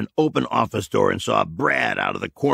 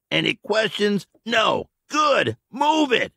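higher, shouted move it; barked dismissal; 11 semitones higher than usual. A narrator's reading. Michael to the men, barking shout. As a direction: send them off hard.

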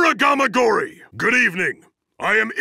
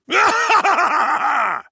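battle laugh; 5 semitones higher than usual. Acted. Fighter laughs mid-battle.

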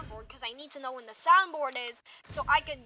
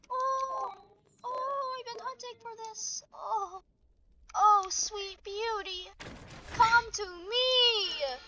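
higher, softer, slow and doting; mock adoring coo; 8 semitones higher, 4 dB quieter, slower than usual. Cradling the rare find, in a softer mock-adoring coo. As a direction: coo at the treasure like a lover. Cooing over found treasure.

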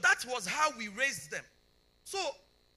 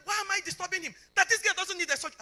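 higher, louder, fast angry rant; angry surgeon; 9 semitones higher, 9 dB louder, faster than usual. Retold or imitated. Angry surgeon scolding the mother over a needless surgery. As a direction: fire it off like an angry doctor. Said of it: "because he was already angry".

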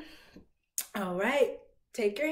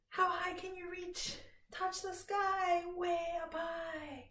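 airy high voice; 5 semitones higher, 5 dB quieter than usual. Shown as a demonstration. Reaching to sky rhyme.

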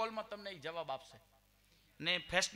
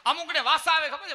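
loud outburst; 9 semitones higher, 13 dB louder than usual. Real, not acted. Stage outburst about possessions.

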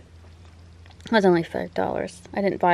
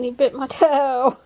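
laughing; 5 semitones higher, 5 dB louder than usual. Real, not acted. Laughing after cat bites toe.

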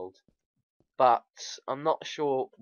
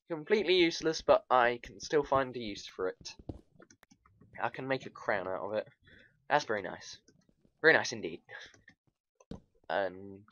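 ordinary delivery

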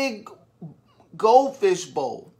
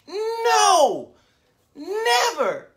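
emphatic no; 11 semitones higher, 3 dB louder, much slower than usual. Acted. Teacher answers her own question.

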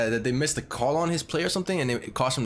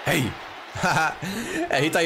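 laughing; 4 semitones higher, much slower than usual. Real, not acted. Laughing at a big hit.

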